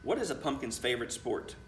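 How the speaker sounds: ordinary delivery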